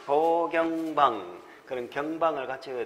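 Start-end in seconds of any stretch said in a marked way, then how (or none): 0.1-1.4 slow mnemonic. Shown as a demonstration. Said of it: "같이 외우도록 하겠습니다".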